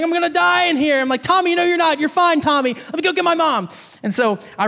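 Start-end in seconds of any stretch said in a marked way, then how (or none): 0.0-1.0 high-pitched mock distress, no real sobs; crying his eyes out. Imitating boy stuck in the storm drain, crying out. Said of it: "he's crying his eyes out". 1.2-3.7 high, hurried-sounding retold reassurance; urgent reassurance. Kid to his stuck friend before getting mom, urgently reassuring.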